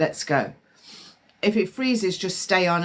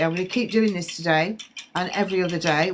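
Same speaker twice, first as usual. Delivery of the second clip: ordinary talk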